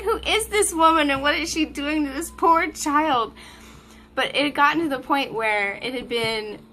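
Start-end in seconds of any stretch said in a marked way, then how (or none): 0.0-3.3 shocked onlookers. Retold or imitated. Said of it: "They were like".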